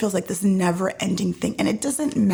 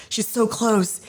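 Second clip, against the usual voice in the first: normal talking voice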